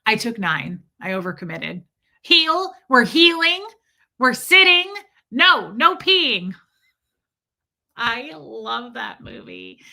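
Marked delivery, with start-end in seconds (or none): 2.2-6.5 loud, high calls to the dogs; firm raised commands to the dogs. Real, not acted. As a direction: call the dogs to heel.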